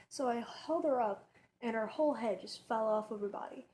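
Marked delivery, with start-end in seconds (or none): none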